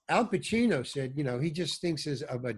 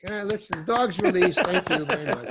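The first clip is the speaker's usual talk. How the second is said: lightly voiced crew announcer, higher; mock release announcement; 4 semitones higher, 5 dB louder than usual. Joking that even a dog gets applause, mock announcer. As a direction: pitch it up like a joking set announcement.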